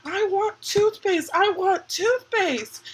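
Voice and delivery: high, slow, whiny mock demand; grandma insisting on toothpaste, whined high; imitating nana. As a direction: plead like a stubborn grandma. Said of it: "she was getting mad".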